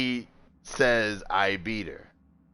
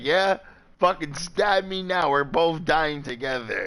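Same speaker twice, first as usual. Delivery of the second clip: defiant yell; 6 semitones higher than usual. Retold or imitated. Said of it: "And I was like".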